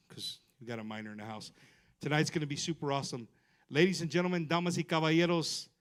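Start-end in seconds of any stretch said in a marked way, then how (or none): 3.7-5.7 lucha libre announcer. Acted. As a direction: ring announcer, booming name call. Host introduces the guest act.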